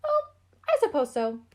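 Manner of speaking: high, loud bored squeak; indifferent mouse. A narrator's reading. Mouse agrees to Gus, high indifferent squeak. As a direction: shrug it off. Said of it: "said Mouse indifferently".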